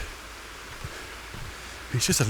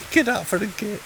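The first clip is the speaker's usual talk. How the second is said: squealing delight; 13 semitones higher than usual. Real, not acted. Delighted at a gate.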